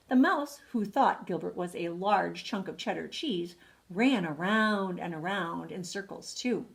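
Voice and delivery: ordinary delivery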